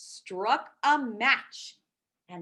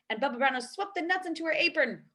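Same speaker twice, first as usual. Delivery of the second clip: ordinary delivery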